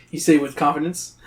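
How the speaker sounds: normal talking voice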